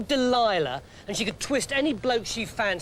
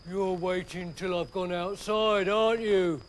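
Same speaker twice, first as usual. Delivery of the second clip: lightly voiced Samson, knowing; Samson, big lumbering hero. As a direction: play the strongman, a touch smug.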